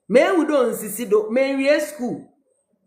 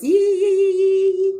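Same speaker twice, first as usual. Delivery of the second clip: excited cheer; 8 semitones higher, 3 dB louder than usual. Real, not acted.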